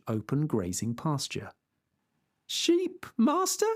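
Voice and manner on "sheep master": incredulous peasant. Acted. Sancho incredulous.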